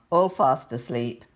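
usual speaking voice

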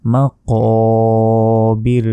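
মাকাবির drawn out very slowly with deep qaf. Shown as a demonstration. Teacher corrects the letter with slow chanting. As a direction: stretch মাকাবির long, qaf from the throat. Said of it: "এখানে আছে কফ".

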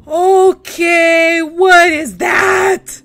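loud, slow startled shout. Real, not acted. Player startled by a strange noise, loud shout. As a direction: jolt at a strange noise, full volume.

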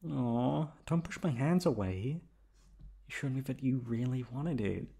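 usual speaking voice

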